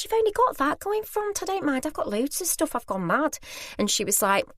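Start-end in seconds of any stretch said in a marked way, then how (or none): 0.0-3.4 very high-pitched retold urging; polite insistence. Shopper urging lady to go first, politely insistent. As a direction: insist in a squeaky-high voice, easing down at the end.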